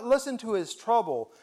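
ordinary delivery

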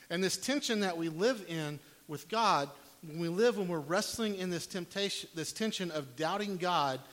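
loud, building preacher's push; preaching. Real, not acted. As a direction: drive it home from the pulpit. Preaching at full volume.

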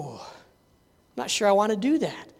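soft, slow, hesitant murmur; acted doubtful hesitation; reluctant. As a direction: balk quietly at the task. Acting reluctant Elijah.

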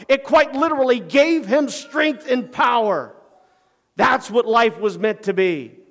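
loud, high-pitched pulpit preaching; preaching at full force. Acted. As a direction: build the sermon to its peak, full voice. Preaching climax.

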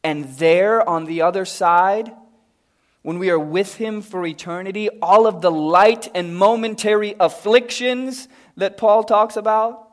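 loud, high, fervent preaching; preaching at full force. Acted. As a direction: drive the promise home to the church. Preacher building to climax.

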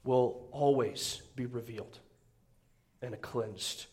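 low, slow and soft, a weighty opening line; solemn slow reading. Acted. Preacher opening the sermon, slow and solemn. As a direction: let each phrase sink in slowly.

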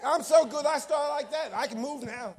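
high, loud, shouted preaching; fiery revival shout; preaching at full force. Acted. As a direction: whip the congregation up, shouting praise.

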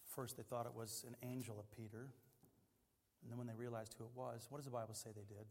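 ordinary talk

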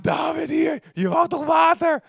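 loud, high proud call; proud shout. Retold or imitated. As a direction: shout it out as you hand over the water. Soldiers proudly present the water. (trots roepend, luid en hoog)

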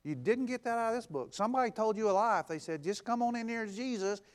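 rising sermon fervor; preaching at full force. Real, not acted. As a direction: drive the point home to the congregation. Preaching climax.

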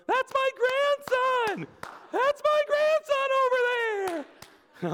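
high, drawn-out yell from the stands; grandma yelling. Retold or imitated. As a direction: shout it proudly across the ballpark. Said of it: "I hear this voice that goes".

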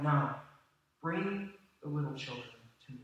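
soft, gentle, unhurried invitation; a kind welcome to the children; gentle Jesus. Retold or imitated. Jesus gently calling the children to him, soft and tender. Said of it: "pushing his disciples gently aside and going".

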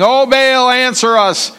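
calling out. Acted. Prophets calling on Baal.

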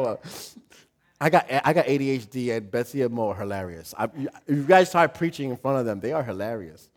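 ordinary talk, nothing marked

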